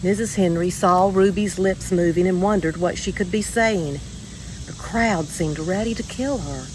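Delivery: plain reading voice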